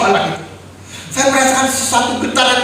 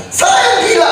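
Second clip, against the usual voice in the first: anguished cry; 9 semitones higher, much slower than usual. Real, not acted. Recalling hysteria at son's death.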